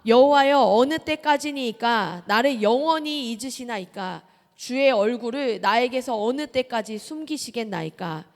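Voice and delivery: loud, slow solemn reading of the psalm; preacher leading the congregation in the psalm, loud and slow; scripture reading aloud. Acted. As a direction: read it out together with weight. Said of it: "함께 같이 읽겠습니다".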